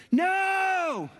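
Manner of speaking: put-on high piercing kid's scream; a child yelling no at the cat; child's shout. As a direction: shriek it like a panicked kid. Kids scream at cat attack.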